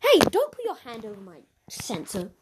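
ordinary talk, nothing marked

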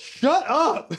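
high, slow, laughing mock annoyance; mock moviegoer. Imitating fellow moviegoers, high laughing mock annoyance. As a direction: play an annoyed stranger while laughing. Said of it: "imitating moviegoers".

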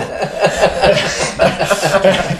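loud high laugh breaking through; laughing at the memory of Mark; real laugh. Real, not acted. Cracking up retelling the Mark story, loud real laugh.

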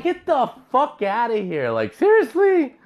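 high-pitched incredulous retort; exasperated disbelief. Retold or imitated. Retold disbelieving retort to lady.

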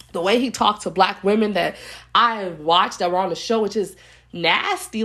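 normal talking voice

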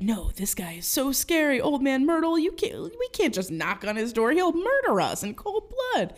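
put-on high, overdramatic kid alarm; Squints panicking. Frightened boy refuses to knock on old man's door, hammed-up panic.

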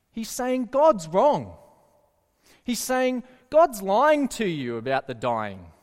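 usual speaking voice

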